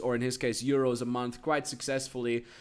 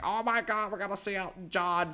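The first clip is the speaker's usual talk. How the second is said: excited mom; 4 semitones higher than usual. Retold or imitated. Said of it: "she was like".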